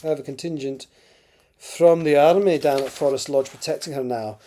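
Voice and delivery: put-on amateur Scottish accent for dialogue; Crothers's lines in an imperfect Scottish accent. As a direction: read it in a rough Scottish accent. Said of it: "Sorry about the terrible Scottish accent".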